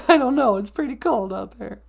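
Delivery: high little partner voice, giggly; reply high and giggly, begging off; high-voiced sidekick, laughing. Sidekick balking at going outside, high giggly voice.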